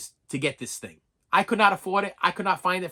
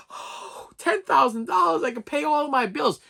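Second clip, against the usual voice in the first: gasp, then high-pitched delight; mock-elated, much higher voice after a gasp; amazed old lady; 10 semitones higher than usual. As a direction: imitate an old lady thrilled by a windfall.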